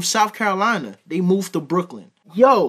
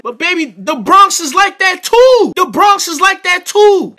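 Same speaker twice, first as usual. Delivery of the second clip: much higher and louder, slowing down; heated loud insistence; 9 semitones higher, 7 dB louder than usual. Real, not acted. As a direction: hammer the point home in exasperation. Heated argument about Bronx.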